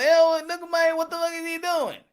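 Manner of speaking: imitating a grossed-out viewer; disgusted crowd. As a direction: sneer at a flabby Mike. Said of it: "Everybody going".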